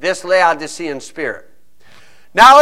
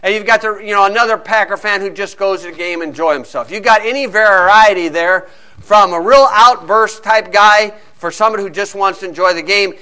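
ordinary delivery

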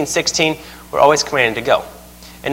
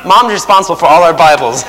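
loud, raised punchline between laughs; mock declaration; 5 semitones higher, 3 dB louder than usual. Parent backtracking to the son, loudly amused. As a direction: proclaim it, cracking up.

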